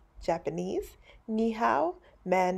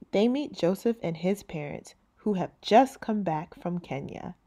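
plain reading voice

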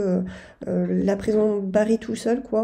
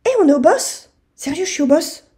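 much higher, louder disbelieving question; surprised; 6 semitones higher, 9 dB louder than usual. Real, not acted. Gamer suddenly finds the boss, loud astonished question.

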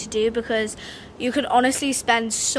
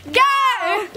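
excited shout; 6 semitones higher than usual. Real, not acted. Starting the challenge countdown.

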